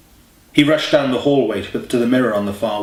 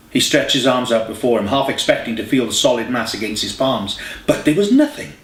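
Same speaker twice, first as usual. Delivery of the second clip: usual speaking voice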